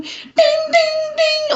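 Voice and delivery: very high sung dings like single keys; ping each ding up very high like a lone piano key; high piano ding imitation. Reviewer mimics the single high piano keys, sung dings. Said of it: "this fucking piano going on".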